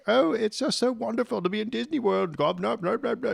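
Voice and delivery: high, gushing fake English accent; bad English accent. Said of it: "my English accent sucks".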